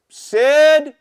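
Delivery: loud high shout of a name; sudden shouted call. Retold or imitated. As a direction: yell across the room for Sid. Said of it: "he goes".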